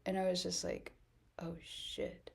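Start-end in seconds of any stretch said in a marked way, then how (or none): 1.4-2.3 breathless whisper of dread; whispered curse. Retold or imitated. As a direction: hush out a horrified curse. Realizing dress worth sixty thousand.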